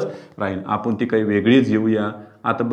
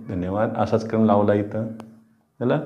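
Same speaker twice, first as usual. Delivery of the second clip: usual speaking voice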